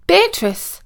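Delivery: high-pitched searching call. A narrator's reading. Prince searching the gardens for Beatrice, calling out high. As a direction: call her name out high, falling away. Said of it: "he was calling out".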